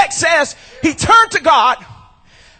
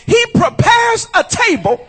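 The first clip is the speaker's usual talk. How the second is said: rising louder and faster to a shouted peak; building preaching climax; 3 semitones higher, 3 dB louder than usual. Acted. Pastor rallying his congregation, preaching up to a shouted climax. Sermon climax, God's promise.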